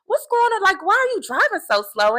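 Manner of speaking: irritated disbelief at the driver ahead; annoyed driver. Retold or imitated. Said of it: "I'm looking to see, like".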